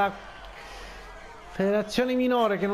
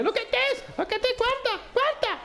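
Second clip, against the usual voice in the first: high, frantic shouting fan; excited fan. Retold or imitated. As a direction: play a crowd member losing his mind. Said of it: "C'è uno esaltato e dice". (tifoso esaltato che strilla acuto)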